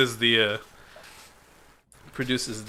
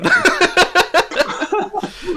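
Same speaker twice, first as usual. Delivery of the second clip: high, loud cackling laugh; hideous laughter; 9 dB louder than usual. Acted. Game master acts out a laughing spell, hideous cackle. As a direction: burst into spell-struck, high-pitched laughter. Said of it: "And cast Tasha's Hideous Laughter".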